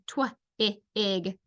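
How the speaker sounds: twig split into tw-, i, ig; segmented phonics demo of tw-, i, ig; sounded out. Shown as a demonstration. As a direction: isolate tw-, short i, then ig with gaps. Teacher sounds out rhyming words.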